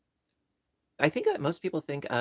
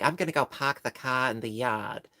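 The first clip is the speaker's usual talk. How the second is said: amateur Boston accent with dropped r's; 3 dB louder than usual. Shown as a demonstration. Streamer showing what people think Boston sounds like, in a mock Boston accent. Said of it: "when you think Boston".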